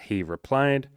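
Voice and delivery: ordinary talk, nothing marked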